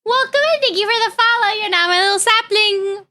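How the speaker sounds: high, quick sing-song thanks; bubbly high welcome to a follower; cutesy thanks. Acted. Streamer welcoming a new follower, high quick sing-song.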